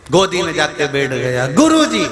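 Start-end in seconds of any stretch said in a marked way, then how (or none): 1.5-2.0 high, imitated crying boy; mock tearful call. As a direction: call out like a pleading child. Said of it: "छोरा रोते रोते गुरुजी के".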